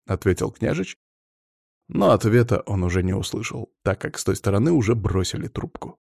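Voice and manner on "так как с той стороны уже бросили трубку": ordinary delivery, nothing marked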